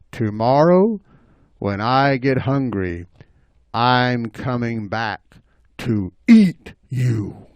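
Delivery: slow, deliberate threat; menacing bobcat. Acted. Bobcat threatening the rabbit, slow menace. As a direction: draw out the threat slowly. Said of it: "stuck his face right up".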